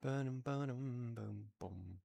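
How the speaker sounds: quiet, imitating a heartbeat; heartbeat imitation. Real, not acted. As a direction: murmur a soft heartbeat rhythm. Soft heartbeat sound effect.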